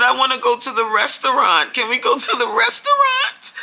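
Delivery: put-on a child angling for a treat; pleading little girl. Little girl begging mom to go to the restaurant, high pleading voice. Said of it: "she said".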